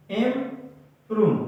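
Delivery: "Improve" slowly split as इम... prove; syllable by syllable. Shown as a demonstration. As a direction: pause between the two parts of "improve". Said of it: "अलग syllable है". (Improve को दो हिस्सों में, रुककर)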